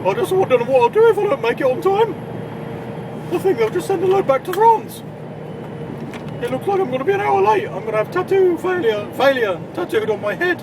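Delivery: a silly high interview voice; mock TV interviewee. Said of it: "I should be doing a Welsh accent for that".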